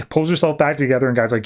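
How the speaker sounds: normal talking voice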